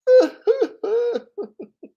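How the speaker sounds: high-pitched put-on sobs; loud fake boo-hoo trailing away; mock sobbing. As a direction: burst into hammed-up, wordless crying. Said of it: "I'm ready to cry again".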